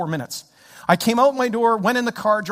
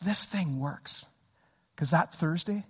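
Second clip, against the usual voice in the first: ordinary talk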